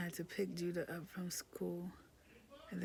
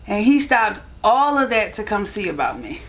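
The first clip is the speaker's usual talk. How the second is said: much higher and louder, still giddy; loud excited gush; 6 semitones higher, 24 dB louder than usual. Real, not acted. Wife praising husband to camera, loud giddy gush. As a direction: gush about him, still buzzing from laughing.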